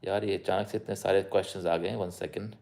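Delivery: normal talking voice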